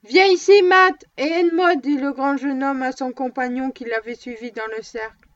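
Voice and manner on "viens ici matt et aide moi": loud, high urgent call; young man calling Matt to help, loud and high. A narrator's reading. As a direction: call him over, loud. Calling companion across the ring.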